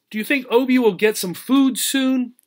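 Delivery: plain reading voice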